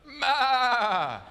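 loud, high answering baa; long, loud, high "maa"; sheep bleat. Retold or imitated. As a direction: bleat back like a second sheep. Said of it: "looks at him and goes".

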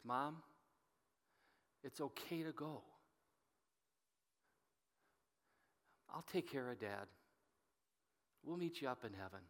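softly, near a murmur, tender goodbye; a son's hushed goodbye at the hospital bed; soft farewell. Retold or imitated. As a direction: ease her into letting go, gently and quietly. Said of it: "I just whisper in her ears".

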